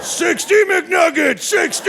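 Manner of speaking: high, drawn-out yell of the order; hammed-up victory shout; triumphant shout. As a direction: bellow it like a war cry.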